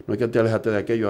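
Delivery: ordinary talk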